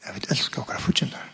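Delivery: soft, breathy whisper. Real, not acted. Preacher to congregation, whispering a personal challenge. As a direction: whisper it close to them.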